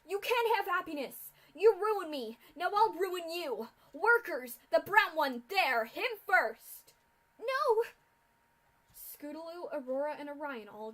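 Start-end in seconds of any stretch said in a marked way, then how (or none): 0.1-6.9 furious yell, high and loud. A narrator's reading. Mare yelling orders at her workers in fury. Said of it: "pointed and yelled with more fury". 7.4-7.8 cry of alarm. A narrator's reading. Ponies cry out as Orion seized.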